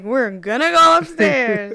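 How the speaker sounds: put-on loud, high, drawn-out drunken cheer; drunk mind. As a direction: whoop it out slowly like your drunk self. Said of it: "But my drunk mind is like".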